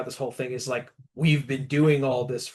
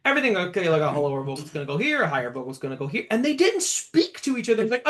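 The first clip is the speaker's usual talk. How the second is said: ordinary talk, nothing marked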